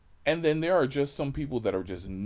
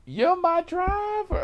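high, drawn-out campy shriek; screaming passenger; 5 dB louder than usual. Retold or imitated. Passenger running out to the driver, campy high scream. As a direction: squeal it loud and slow in falsetto. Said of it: "just to come screaming".

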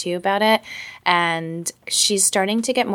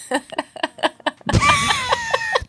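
big high-pitched burst of laughter. Real, not acted. Laughing at chili joke.

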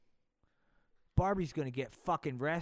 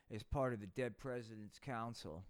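normal talking voice

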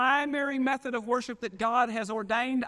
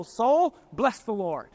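commanding; 4 dB louder, slower than usual. Acted. Preacher as David speaking to his soul, commanding. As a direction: summon your soul, then settle into the order. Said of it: "he was saying to his soul".

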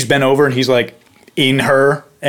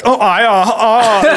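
lightly voiced German porn grunting, higher; hammed-up German porn moan, pitched up; mock German porn; 9 semitones higher, much slower than usual. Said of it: "like, a German porn and they're like".

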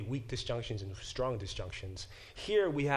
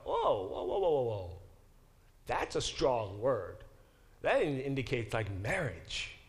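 amazed ancient reader. Retold or imitated. Teacher voicing an ancient reader struck by the word, amazed. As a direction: play the stunned ancient reader.

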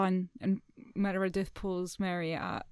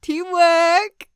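high, loud cheer; jubilant cheer; 9 semitones higher, 11 dB louder than usual. Real, not acted. Player celebrating a joint rescue move, jubilant cheer. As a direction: call it out in triumph.

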